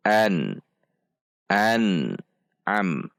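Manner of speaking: an with a clear N, briefly held and released; clear izhar. Shown as a demonstration. Teacher models correct izhar, an clear and unjerked. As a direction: say an clearly, neither chopped nor droned. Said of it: "namun diizharkan".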